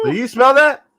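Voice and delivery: high, loud taunting question; gloating tease. Pretend. Son teasing his mom who voted the other way. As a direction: rub it in with a smug lilt. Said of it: "be like".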